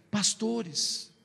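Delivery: usual speaking voice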